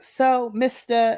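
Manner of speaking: a syrupy Southern welcome, honey and darling; Southern lady. Acted. Principal greets the new groundskeeper, sugary Southern drawl. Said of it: "This is very Southern, like, lady".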